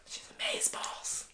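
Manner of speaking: very soft, voiceless whisper; whispered aside. Real, not acted. Friend gushes over Emma Frost, whispered. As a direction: slip it in under your breath.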